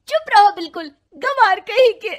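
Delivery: screaming high and loud in rage; angry shriek; furious shout. Acted. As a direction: yell it at him with full fury. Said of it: "वो जोर से चिल्लाती है".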